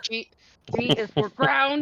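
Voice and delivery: loud, punchy announcement; emphatic announcement. Acted. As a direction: proclaim it with a grin. Teaching keybind mnemonic joke.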